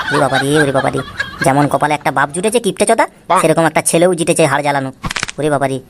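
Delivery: low, muttered complaint to himself; grumbling aside. Acted. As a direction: curse your luck under your breath. Father grumbles aside about son. (নিচু গলায় গজগজ করা বাবা)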